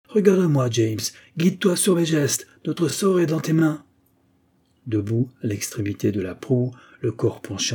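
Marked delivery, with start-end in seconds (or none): 0.1-3.8 raised, urgent instructions; taking command. A narrator's reading. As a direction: direct the helmsman with authority. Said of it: "prenant d'instinct le commandement". (prise de commandement)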